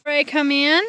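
loud high call to the goats. Real, not acted. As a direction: call the animals in from afar. Calling goats in.

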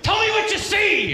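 hammed-up Scent of a Woman line; mock old blind man. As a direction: ham up a movie mentor. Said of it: "He'll be like".

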